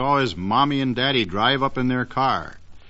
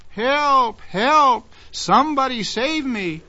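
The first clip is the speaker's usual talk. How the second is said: cries for help; 12 semitones higher, slower than usual. Acted. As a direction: yell up into the sky for rescue. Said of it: "his cries for help".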